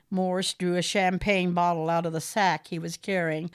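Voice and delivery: ordinary delivery, nothing marked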